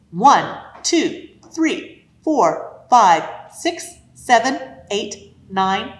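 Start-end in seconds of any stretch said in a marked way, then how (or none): none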